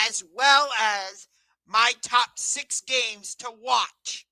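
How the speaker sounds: ordinary talk, nothing marked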